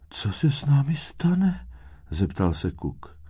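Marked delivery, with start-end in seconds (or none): 0.1-1.7 small, very high worried voice; Kuk. A narrator's reading. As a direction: ask anxiously like a tiny child. Little Kuk asks anxiously.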